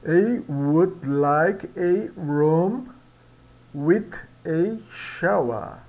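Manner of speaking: drawn-out words; slow model sentence. Shown as a demonstration. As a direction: say it slowly and clearly for the class. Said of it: "Vamos adiante". (frase-modelo pronunciada devagar)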